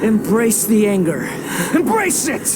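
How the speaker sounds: gleeful goading. Acted. Villain urging the traveler to give in to anger, gleeful and rising. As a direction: whip up his fury with mounting glee.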